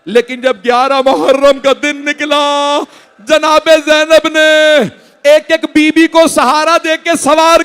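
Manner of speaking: high, loud, very slow wailing cry; weeping lament. Acted. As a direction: wail the final scene as if sobbing. Climactic lament, crying.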